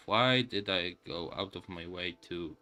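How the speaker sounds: usual speaking voice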